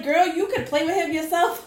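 laughing quote. Retold or imitated. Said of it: "They're like".

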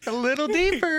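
mock-sweet falsetto, luring someone down; put-on creepy seller. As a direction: stretch it out in a very high put-on voice. Same creepy seller luring him down.

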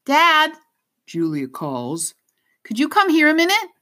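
put-on high, fast girl's call; young girl calling. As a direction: call out urgently for dad. Girl calling her father.

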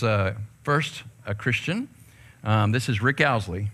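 ordinary delivery

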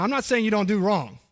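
ordinary delivery

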